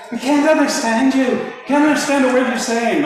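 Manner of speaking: high, loud mock Irish lilt; Irish waitresses. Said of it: "they're like".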